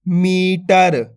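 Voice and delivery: slowly blended मीटर, long ee; मीटर sounded out for learners; drawn-out long vowel. Shown as a demonstration. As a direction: stretch मी, then sound टर in मीटर. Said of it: "खींच के पढ़ना है मीटर".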